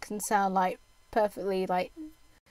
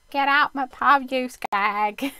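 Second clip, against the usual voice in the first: put-on EastEnders accent; 2 semitones higher, 6 dB louder, slower than usual. Streamer jokingly doing an EastEnders pub line for chat, loud Cockney. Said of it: "I sound like someone from EastEnders".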